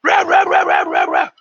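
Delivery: furious high shout, then growled rah rah rah; angry yelling boss. Retold or imitated. Boss screaming at cook over the shock, furious shout. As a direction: bellow like a chef losing it. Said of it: "He's like".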